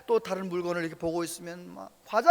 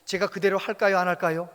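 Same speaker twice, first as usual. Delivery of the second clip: ordinary talk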